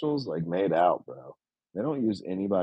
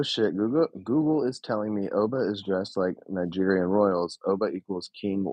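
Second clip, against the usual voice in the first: usual speaking voice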